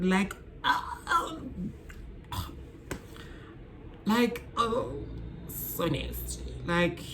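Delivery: high ah, then soft breathless so nasty; disgust. Real, not acted. Imagining attraction to a friend's partner, disgusted. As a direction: recoil in disgust.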